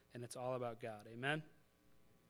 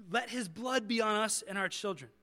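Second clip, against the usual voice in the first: mock crowd yell; 5 semitones higher, 5 dB louder than usual. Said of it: "that's what they yell".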